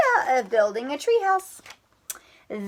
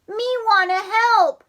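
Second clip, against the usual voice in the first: little sister voice; 9 semitones higher, 3 dB louder than usual. Acted.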